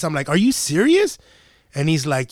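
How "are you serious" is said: high, rising question of disbelief; incredulous challenge. Retold or imitated. Driver to cop at his window, incredulous and high-pitched. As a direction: question him as if it's absurd. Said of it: "I tell him just like this".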